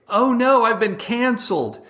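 hammed-up shriek of dismay; mock panic. Parodying the right, a high mock wail of panic. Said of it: "it's this sort of like".

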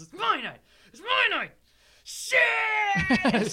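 hammed-up high squeal building to a scream; acted shriek. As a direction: screech it like a girl gone wild. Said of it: "She goes".

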